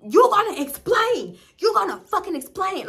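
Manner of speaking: reenacted screaming demand; retold yelling. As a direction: yell it, going off on him. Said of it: "I'm going off on him".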